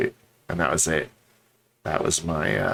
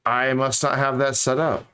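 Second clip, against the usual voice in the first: ordinary talk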